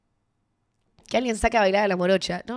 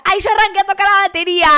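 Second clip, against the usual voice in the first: loud, high, over-eager babble; self-mocking gush; 11 semitones higher, 10 dB louder than usual. Drunk fan talking to the drummer, self-mocking loud gush. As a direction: gush in mock fangirl excitement.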